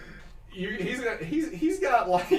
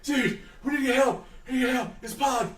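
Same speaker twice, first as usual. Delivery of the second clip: higher, breathless pleading; high nervous babble; panicked guard; 6 semitones higher, faster than usual. Acted. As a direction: beg urgently, out of breath. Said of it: "He's like".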